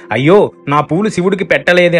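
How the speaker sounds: lightly voiced regret over the flowers; ఒకింత బాధతో; grieving lament. A narrator's reading. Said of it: "అని బాధపడిపోయాడు".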